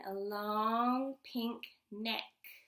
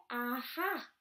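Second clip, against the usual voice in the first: gotcha. Acted. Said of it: "I got you".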